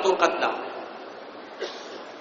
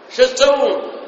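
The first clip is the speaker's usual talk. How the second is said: "شو تسوون" shouted higher and louder with a sharp fall; rebuking shout; 6 semitones higher, 4 dB louder, slower than usual. Retold or imitated. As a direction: cry out to stop the fight. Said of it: "قال". (صيحة توبيخ عالية)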